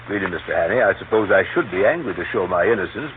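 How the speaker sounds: ordinary talk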